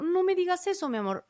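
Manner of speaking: mother to son, gently pleading in a high voice; changed, softened voice. Retold or imitated. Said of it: "ya cuando yo cambié la voz".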